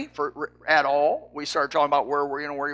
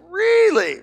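surprised; 13 semitones higher, 5 dB louder than usual. Retold or imitated. Telling Ernie snipe is real.

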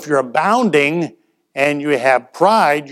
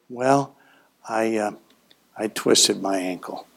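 low, soft and sorry for himself; pitiful injured voice; 5 semitones lower, 7 dB quieter than usual. Pretend. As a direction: play the wounded churchgoer fishing for pity. Limping into church, acting hurt.

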